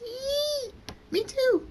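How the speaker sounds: put-on shrill-pitched girlish delight; excited squeal. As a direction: squeal it like a thrilled little girl. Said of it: "Kate says".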